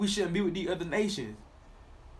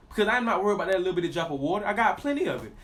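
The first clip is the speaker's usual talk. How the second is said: ordinary talk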